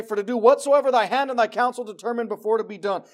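loud, high, preached scripture; preaching scripture. Acted. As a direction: proclaim the verse with force. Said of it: "it goes on to say".

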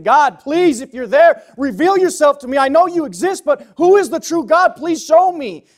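loud, high, pleading prayer; acted desperate cry to heaven. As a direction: plead to God at the top of your voice. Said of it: "they're crying out and they're saying".

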